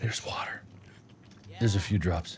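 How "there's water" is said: awed whisper. Real, not acted. Finding water.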